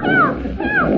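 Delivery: calling for help. Acted. Red calls for help.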